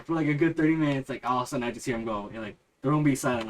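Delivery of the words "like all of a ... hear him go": ordinary talk, nothing marked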